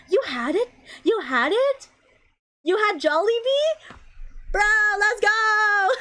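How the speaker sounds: high, incredulous delight building to a cheer; overjoyed. Real, not acted. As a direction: squeal it in real excitement, ending in a laugh. Overjoyed friend had the same food.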